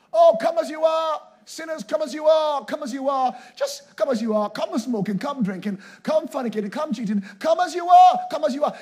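loud, high, rapid-fire shouted invitation; mock open invitation. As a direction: call it out like a crowd hawker, mocking. Mocking welcoming churches' open invitation.

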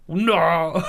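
loud, high put-on cry; hammed-up drawn-out protest; mock horror. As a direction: stretch it into a play-acted howl of refusal. Acting Seuss objecting to being cooked.

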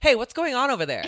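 high, loud stern substitute teacher call; angry substitute. Retold or imitated. Comic calling out the crowd, stern substitute teacher. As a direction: call out the back row like a cross teacher. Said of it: "You sound like an angry substitute".

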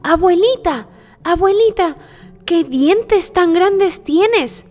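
put-on high child voice, drawn-out question; high-pitched childlike wonder; amazed little girl. Girl marvels at disguised wolf.

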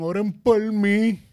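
high, slow, loud theatrical wail; exaggerated high moaning mockery; mock dramatic plea. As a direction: ham up the sick person begging for prayers. Mocking covid patients' pleas.

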